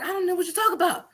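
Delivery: high, laughing denial; asked about the stripper, acting innocent; playing innocent. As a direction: feign ignorance through a laugh. Denying the stripper story.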